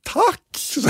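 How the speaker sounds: thanking a cheering crowd, overjoyed; overjoyed thanks. Retold or imitated. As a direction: squeal the thanks. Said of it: "Och blev helt så här liksom".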